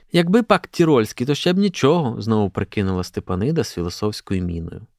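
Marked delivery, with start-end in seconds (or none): 0.1-2.2 higher, emphatic matron voice; disapproving verdict. A narrator's reading. Stepanyda weighing in on the hat, disapproving. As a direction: pronounce judgement with raised pitch. Older woman judges the peasant hat.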